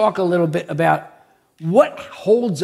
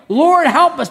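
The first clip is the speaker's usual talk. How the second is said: loud, high plea; crying out; 7 semitones higher, 7 dB louder than usual. Acted. Preacher mimics believers praying like the hanging man, crying out. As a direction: call out to heaven for help. Acted plea, echoing the joke's scream.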